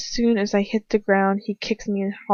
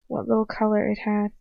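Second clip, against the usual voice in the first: ordinary delivery, nothing marked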